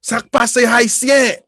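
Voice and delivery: loud, high, slow Haitian greeting; excited greeting. Retold or imitated. Haitian classmate greeting the speaker, loud and delighted. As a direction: call out with delight at a fellow countryman. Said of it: "and it's like".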